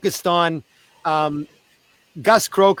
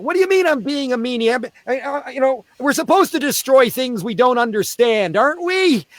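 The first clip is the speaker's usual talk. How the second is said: high, mock-innocent clown; clown protesting; 8 semitones higher than usual. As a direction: play the naive clown. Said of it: "And I went".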